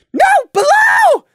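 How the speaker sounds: very high, loud, urgent warning; frantic shout. Acted. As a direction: yell the danger you see. Prey warning of danger below.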